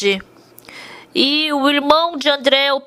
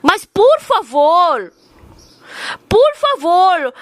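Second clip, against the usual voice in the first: drawn-out, slow pleading please; slow begging; 4 semitones higher, much slower than usual. Retold or imitated. Loan shark begging the wife, slow drawn-out please. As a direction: stretch each por favor as you beg.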